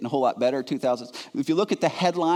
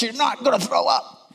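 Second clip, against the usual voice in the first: brusque nurse; 9 semitones higher than usual. Retold or imitated. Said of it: "She said".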